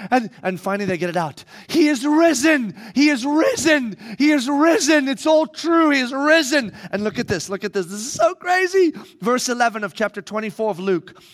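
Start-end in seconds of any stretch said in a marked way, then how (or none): 1.7-6.7 higher, louder triumphant repetition; women shouting the news to the disciples; joyful proclamation. Acted. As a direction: proclaim it with joy.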